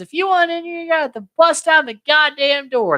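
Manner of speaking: high-pitched, hammed-up challenge; mock defiant yell. Wife daring police to break in.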